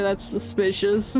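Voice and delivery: ordinary delivery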